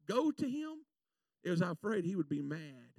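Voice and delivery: normal talking voice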